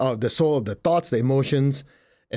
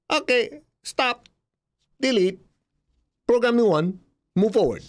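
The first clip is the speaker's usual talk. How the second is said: mock simple command; 9 semitones higher than usual. Acting out a simple reset.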